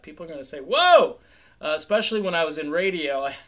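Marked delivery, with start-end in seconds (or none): 0.6-1.2 startled whoa. Retold or imitated. People reacting to his accent.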